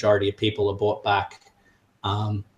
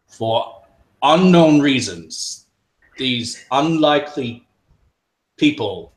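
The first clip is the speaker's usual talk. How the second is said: slow, louder proclamation; booming authoritative voice; 5 semitones higher, 7 dB louder, slower than usual. Acted. The mantle addressing everyone, booming and authoritative. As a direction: announce it like a ruler. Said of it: "his booming authoritative voice".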